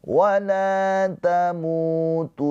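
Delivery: slow, high, sustained recitation; tilawah Al-Qur'an. Shown as a demonstration. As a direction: recite slowly, holding each long note. (tilawah pelan dengan nada tinggi)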